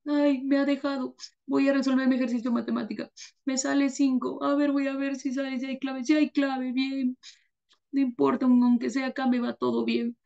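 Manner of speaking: high, mock-weepy voice muttering through the exercises; tearful student studying. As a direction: sniffle along while solving math problems. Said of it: "con lágrimas, sigue resolviendo". (alumno lloroso y agudo que resuelve ejercicios)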